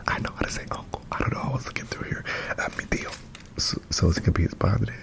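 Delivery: breathy ASMR whisper. Real, not acted. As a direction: whisper it close to the mic.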